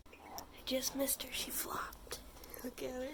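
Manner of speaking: dropped to a hush so the rabbit isn't disturbed; barely audible, near whisper; soft hush while filming a pet. Real, not acted. Softly watching rabbit flop.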